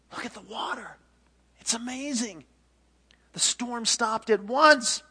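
high wonder building to loud; amazed sailor. Acted. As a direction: marvel with growing astonishment. Sailors marvel at still water.

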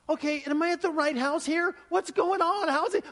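high, loud, bewildered questions; a pastor arriving to find calm instead of crisis, baffled and loud; retold bewilderment. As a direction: sound thrown by what he walked into.

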